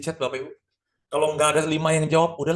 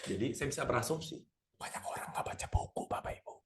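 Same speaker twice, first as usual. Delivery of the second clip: hushed; 11 dB quieter than usual. Real, not acted. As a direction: drop into a whisper, much softer. Confiding aside to audience. (berbisik pelan)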